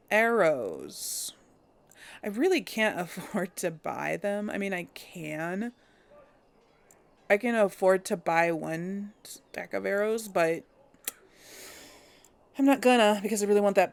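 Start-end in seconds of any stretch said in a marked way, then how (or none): none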